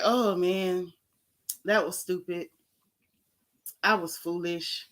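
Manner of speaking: low, quiet imitation of his apology; mock insincere regret. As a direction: mimic him apologizing without meaning it. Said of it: "don't even sound like you meant that".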